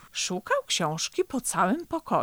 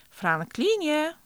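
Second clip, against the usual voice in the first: mum's drawn-out, higher-pitched call; a slow, high motherly call; 8 semitones higher, slower than usual. A narrator's reading. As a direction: call the child by name, stretching it out. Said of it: "zawołała mama".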